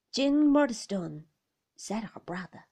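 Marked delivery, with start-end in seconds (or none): 0.1-1.3 loud, slow, raised-voice naming; stern rebuke. A narrator's reading. Brother silencing his sister, loud and stern. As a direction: draw out the name as a warning.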